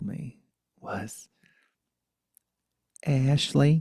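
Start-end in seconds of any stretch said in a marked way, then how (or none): 3.1-3.8 loud Brooklyn grandmother; Brooklyn accent. Retold or imitated. Grandmother calls her grandchild, Brooklyn accent. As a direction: call out in a higher Brooklyn accent. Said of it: "'cause she had a Brooklyn accent".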